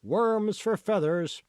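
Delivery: merchant's cry. A narrator's reading. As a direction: sing it out like a peddler hawking wares. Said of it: "the merchant cried out".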